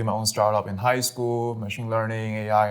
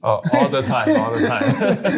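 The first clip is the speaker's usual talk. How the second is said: amused, laughter breaking through, drawn out; laughing; 8 semitones higher, 6 dB louder, slower than usual. Real, not acted. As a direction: repeat it slowly through a high laugh. Laughing at being mistaken.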